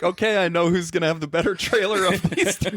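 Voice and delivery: laughing. Retold or imitated. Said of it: "I'm like".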